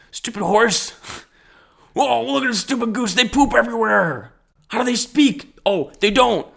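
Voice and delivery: put-on high, childish sneering voice; mocking kid self. Remembering his kid self sneering at a horse, high childish voice. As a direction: play your dumb little kid self scoffing at animals. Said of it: "when I was a kid".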